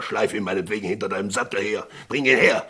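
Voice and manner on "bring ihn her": louder, higher command; loud order. Acted. Sheriff commanding Tom to bring Pitt, loudly. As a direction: bark the order out.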